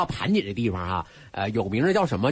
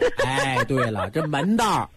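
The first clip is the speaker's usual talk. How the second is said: amused agreement through laughter; laughing; slower than usual. Real, not acted. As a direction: agree while laughing along. Laughing over the shrimp joke. (边笑边说)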